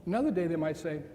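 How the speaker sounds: usual speaking voice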